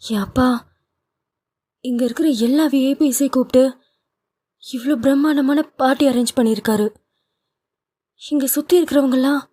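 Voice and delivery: a wife scolding her husband at her father's party; Nithya rebuking. A narrator's reading.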